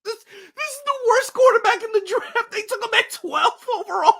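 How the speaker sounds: high voice cracking with laughter; laughing disbelief. Real, not acted. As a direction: talk through helpless laughter. Laughing disbelief at draft pick.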